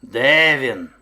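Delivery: loud, rising bellowed demand; booming impatient bear; bear shouting. A narrator's reading. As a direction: call out furiously as a hunting bear. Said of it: "кричав ведмідь".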